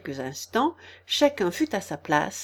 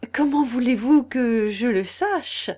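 louder, slow, bold retort; bold courage; 3 semitones higher, 5 dB louder than usual. A narrator's reading. Alice answering the Queen back, bold and slow. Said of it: "avec un courage qui la surprit".

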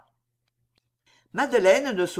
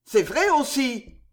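angered; 6 semitones higher, 4 dB louder, slower than usual. Acted. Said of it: "tout de suite courroucée".

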